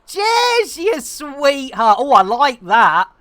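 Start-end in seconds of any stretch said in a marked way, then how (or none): 0.0-2.0 delighted greeting. Real, not acted. Greeting a viewer.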